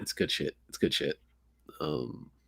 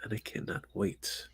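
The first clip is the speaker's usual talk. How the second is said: hushed excited whisper; very soft, breath-only whisper; 2 semitones lower, 12 dB quieter than usual. Real, not acted. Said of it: "Why are we whispering".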